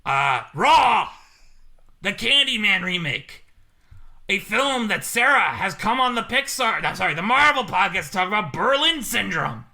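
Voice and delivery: loud, drawn-out announcing of each title; put-on movie villain reading out a list; Jigsaw voice. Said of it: "I can't imagine being Jigsaw".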